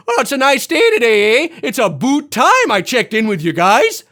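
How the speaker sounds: loud, high, exaggerated Canadian accent; mock Canadian accent. Asking viewers if they ever heard this, loud mock Canadian accent. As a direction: belt out a caricature Canadian greeting. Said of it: "when was the last time you heard me go".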